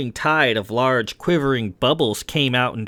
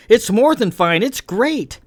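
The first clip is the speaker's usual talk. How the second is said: high, excited reassurance; thrilled, pitch climbing on the praise; delighted enthusiasm; 11 semitones higher, 6 dB louder than usual. Acted. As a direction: gush in a much higher voice. Character delightedly praising the cloak.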